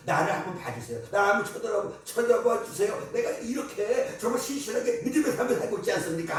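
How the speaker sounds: impassioned pleading sermon voice; preaching. Acted. Preacher voicing David begging God to look. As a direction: plead with God through the congregation.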